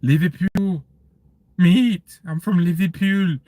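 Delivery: rusty attempt at a Scouse accent; Liverpool accent. Shown as a demonstration. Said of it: "do a really good Liverpool".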